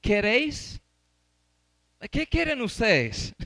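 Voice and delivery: slow, mock-questioning Pilate; lightly acted governor asking the crowd; Pilate's question. Shown as a demonstration. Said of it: "Miren cómo preguntó Pilato".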